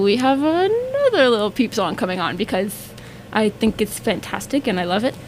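usual speaking voice

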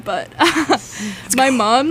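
ordinary delivery

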